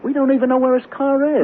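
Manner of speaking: high, loud panicked voice; frightened husband in the storm; panicky. Acted. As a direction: voice jumping up in alarm.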